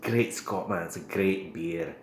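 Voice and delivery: hammed-up Scottish brogue; a proud, jokey Scottish voice; Scottish accent. Toasting the beer to viewers in a put-on Scottish accent. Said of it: "I apologize for the poor accent".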